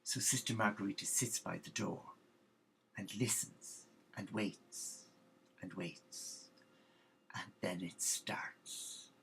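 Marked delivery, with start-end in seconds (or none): 3.0-9.1 trailing off into a breathless hush; fading to a whisper. A narrator's reading. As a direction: drop slowly from quiet to a hushed whisper. Waiting in the dark dormitory.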